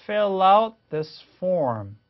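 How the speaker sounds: "Fill out this form" slowly, fil-lout joined; linking demo. Shown as a demonstration. Teacher modelling for learners, "Fill out this form" slow and linked. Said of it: "요게 요렇게 연결되면서".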